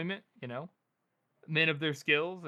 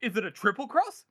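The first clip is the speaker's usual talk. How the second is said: paranoid questioning; 5 semitones higher, 6 dB louder than usual. Retold or imitated. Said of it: "she's, like, all paranoid".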